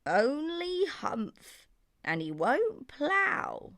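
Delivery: very slow, high ox voice; ox complaining. Acted. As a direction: drag it out like a plodding ox. Ox tattles.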